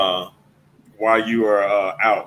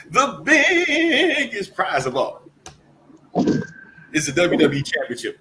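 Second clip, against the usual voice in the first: announcer hype; 5 semitones higher than usual. Acted. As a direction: sell it like the main event. Announcer build-up.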